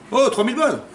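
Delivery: high, outraged exclamation; indignant outburst. Acted. Husband who paid for the ring, indignant outburst. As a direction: burst out high, affronted by the reaction.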